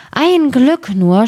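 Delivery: a plucky tailor, relieved; lightly voiced relieved tailor. A narrator's reading. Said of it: "sprach es".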